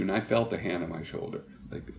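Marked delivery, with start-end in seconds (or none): none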